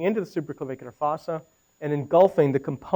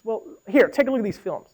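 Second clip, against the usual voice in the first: lightly imitated department head, pitched up; head of ENT; 5 semitones higher than usual. Head of ENT handing films to radiologist, lightly imitated voice. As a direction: mimic a colleague in a higher voice. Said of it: "You should talk like this".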